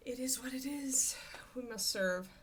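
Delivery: breathy resigned whisper. Real, not acted. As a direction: whisper it, giving up.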